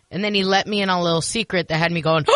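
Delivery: ordinary talk, nothing marked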